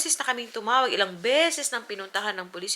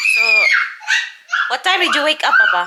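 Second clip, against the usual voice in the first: calls across the room, louder and higher; calling out; 8 dB louder than usual. Real, not acted. Mother calls out to papa across the house, louder and higher. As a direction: raise the voice to reach Papa.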